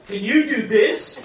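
put-on loud, high, slow child's question; boy's voice. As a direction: play the cheeky little boy, bold and loud. Little son's quote at table.

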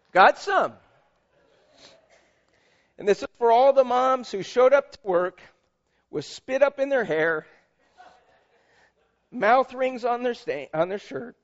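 sermon tribute read with lifted voice. Acted. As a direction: proclaim it to every mother in the room. Continues Mother's Day tribute reading.

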